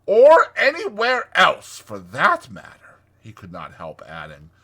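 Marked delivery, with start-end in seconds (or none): 0.0-3.0 boisterous. A narrator's reading. Toad brags about his house, boisterous. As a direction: boast it boisterously. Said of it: "cried Toad boisterously".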